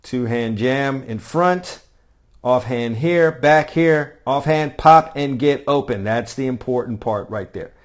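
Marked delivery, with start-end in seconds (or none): 0.0-6.0 slow, drawn-out drill cues with a loud pop; slow-mo callout. Shown as a demonstration. Coach calling drill steps slowly over slow-motion clip, punchy on pop. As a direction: call each step slowly as the clip crawls. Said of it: "let me do this in slow-mo for you".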